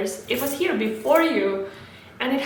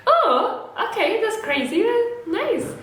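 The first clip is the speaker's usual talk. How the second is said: amused high voice; 9 semitones higher than usual. Retold or imitated. Strangers smiling at tree hugger.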